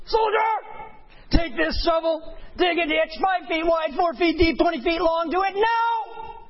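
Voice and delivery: high barking drill sergeant orders. Retold or imitated. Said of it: "the drill sergeant says something like this".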